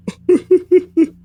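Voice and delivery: high-pitched burst of laughter; loud high laugh. Real, not acted. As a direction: let out a big squealing laugh. Big laugh at game.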